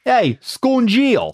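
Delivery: high, slow Jersey-guy drawl. Acted. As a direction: put on a Jersey wise guy accent. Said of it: "like, a Jersey guy".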